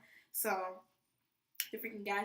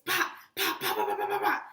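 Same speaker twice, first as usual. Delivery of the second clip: gunshot noises; 12 semitones lower, 7 dB louder than usual. Retold or imitated. Imitating gunfire on the range.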